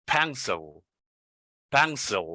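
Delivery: "pencil" with a reduced second syllable, like "pen-sul"; correct pencil. Shown as a demonstration. Teacher modelling a word from its transcription, careful pronunciation. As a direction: let the end of "pencil" fall into a dark l. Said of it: "It's not pencil".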